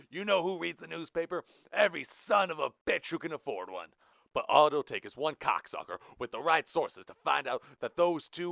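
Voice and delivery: high, loud furious yelling; angry shouting. Acted. Blue shouting at Rich over the press. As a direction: bellow it in his face. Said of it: "Blue ignored Rich's whimpering grunts and shouted".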